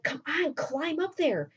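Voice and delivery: high-pitched, insistent retold coaxing; acted-out prodding; urging. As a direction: egg on a reluctant friend.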